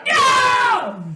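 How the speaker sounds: mock horrified shout. Friend refusing a peed-on high five with a loud shriek. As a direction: recoil with a shrieked no. Recoiling again.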